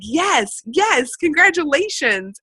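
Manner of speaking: mock celebrate their bad news; excited. To a sick customer, excitedly congratulating. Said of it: "I've learned to be excited".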